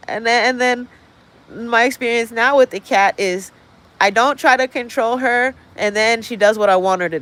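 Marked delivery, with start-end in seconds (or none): none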